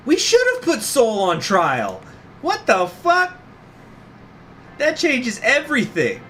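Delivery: loud, high stunned outrage; outraged disbelief. Real, not acted. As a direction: protest it in shock. Outraged by lore reveal.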